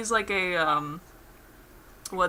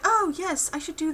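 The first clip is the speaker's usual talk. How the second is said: mock eager yes; 10 semitones higher than usual. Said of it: "And he's like".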